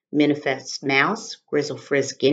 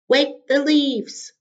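stronger voice; 11 semitones higher, 4 dB louder, much slower than usual. Acted. Wadney's voice was stronger.

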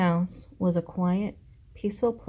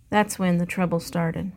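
plain reading voice

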